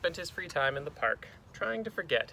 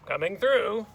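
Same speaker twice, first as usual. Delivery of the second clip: loud, high call; brash, raised teacher shout; stomping teacher; 7 semitones higher, 7 dB louder than usual. Acted. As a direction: holler to clear the way. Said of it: "Miz Kirby still stomped".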